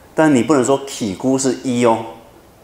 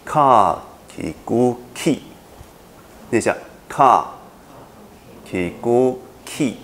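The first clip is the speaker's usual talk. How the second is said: ka ki gu ki said slowly and even; slow spelling; 3 semitones higher, slower than usual. Shown as a demonstration. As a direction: lead the repeat at a slow pace.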